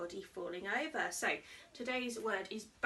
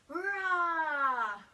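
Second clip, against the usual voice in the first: louder playful rah; teacher roaring like a lion for kids; 4 semitones higher, 7 dB louder than usual. Pretend. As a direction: roar like a friendly lion. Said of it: "a big push-up and a roar".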